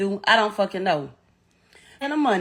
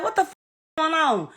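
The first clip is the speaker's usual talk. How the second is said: a much higher, alarmed cry of disbelief; sudden high-pitched retold panic; shocked outburst; 6 semitones higher than usual. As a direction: blurt it out as panic hits. Panicked retold reaction, son shot.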